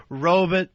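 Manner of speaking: ROBOT said as "ROE-bit"; imitated mispronunciation. Co-host showing partner's odd stress, imitated mispronunciation. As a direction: stress the first syllable of ROBOT, swallow the second. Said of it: "puts the stress on the first syllable".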